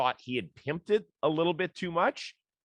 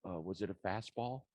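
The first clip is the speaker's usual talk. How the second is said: a meek, hushed reporter's question, much softer; timid quiet question; 4 semitones lower, 11 dB quieter than usual. Retold or imitated. Young reporter timidly breaking the silence, hushed and meek. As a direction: ask it meekly and quietly, almost swallowed. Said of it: "And finally somebody said".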